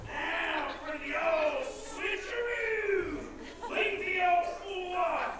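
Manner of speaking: loud, laughing villain gloat. Acted. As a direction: gloat like a cackling crook. Villain gloats over switch.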